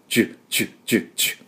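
ジュ hissed softly like sauce sizzling on grilled meat; soft sizzle, ジュ ジュ. Shown as a demonstration. Teacher imitating grilled meat sizzling, soft hiss. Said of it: "焼肉のタレを乗せた時のジュ".

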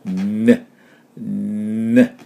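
"ね" with a long hummed N before the vowel; sticky long N. Shown as a demonstration. Teacher demonstrating ね like sticky natto, lengthened N. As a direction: hold the N of "ね" through the nose like sticky natto. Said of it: "粘る納豆をイメージして".

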